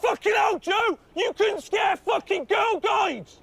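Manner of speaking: ordinary talk, nothing marked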